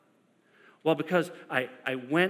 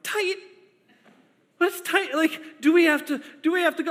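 much higher panicky questions; panicked husband; 12 semitones higher than usual. Retold or imitated. Husband panics at the wife's labor, asking in a much higher voice. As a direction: panic rising as you ask. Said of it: "I'm like".

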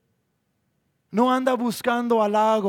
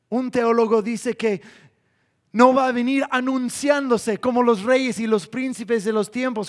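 normal talking voice

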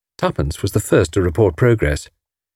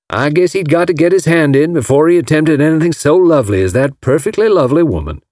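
put-on Alabama accent; 6 semitones higher than usual. A narrator's reading. Hank Ryder praising Marguerite to Tommy, Alabama accent. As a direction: gush admiringly like an Alabama gentleman. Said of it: "Mister Ryder came from Alabama".

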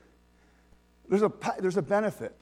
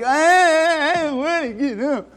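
a high, louder, mock-whiny groan; self-pitying whine; 8 semitones higher, 7 dB louder, much slower than usual. Preacher acting out his grumpy self-pitying morning, mock whine. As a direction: whine like a sulky child feeling sorry for himself. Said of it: "just self-pitying".

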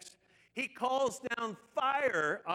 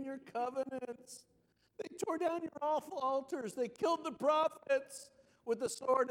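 whiny, high-pitched complaint; Elijah; 5 semitones higher than usual. Acted. Depressed prophet complaining to God, in a whiny voice. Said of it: "read verse fourteen with the whiny voice".

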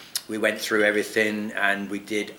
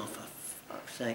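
slow unvoiced mutter under the breath; muttered curse; 16 dB quieter, slower than usual. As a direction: grumble it to yourself, slow and barely voiced. Annoyed at family sitting nearby.

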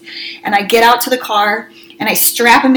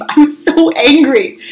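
slow, higher "so angry", both words stretched; emphatic; 5 semitones higher, 3 dB louder, slower than usual. Retold or imitated. Mom recalling the car tantrum, emphatic. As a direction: lean hard on "so angry", drawing it out. Recalls her own fury, drawn out.

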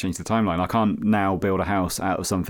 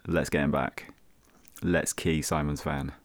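plotting; 5 semitones lower, 6 dB quieter, slower than usual. Retold or imitated. Conspirators plotting revenge.

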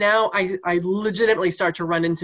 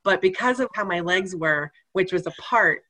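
ordinary talk

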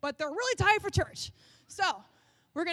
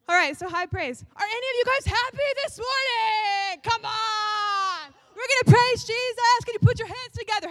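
loud, much higher, shouted hype; high praise hype; 6 semitones higher, 6 dB louder than usual. Pretend. Worship leader hyping a happy congregation, shouting high and loud. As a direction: pump up the crowd.

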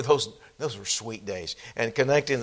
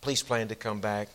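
ordinary delivery

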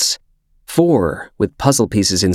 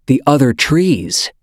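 excited; 7 semitones higher than usual. Acted. Boy's sudden realization.

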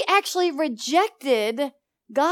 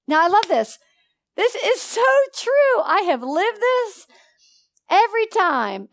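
much higher, louder outburst of conviction; excited exclamation; 8 semitones higher, 4 dB louder than usual. Real, not acted. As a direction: lift the voice high with delight. Preacher exclaims with passion.

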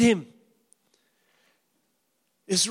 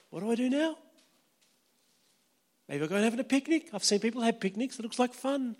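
mock clueless Satan; 6 dB quieter, faster than usual. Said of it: "And he goes".

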